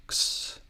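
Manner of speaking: "ks" as a bare voiceless cluster, like the end of books; isolated "ks" sound, k running into s; double consonant sound. Shown as a demonstration. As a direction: sound out "ks" without any vowel. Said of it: "consonant sound, like in books".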